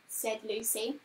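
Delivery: plain reading voice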